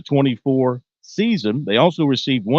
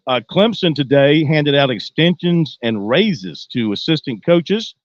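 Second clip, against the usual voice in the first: ordinary delivery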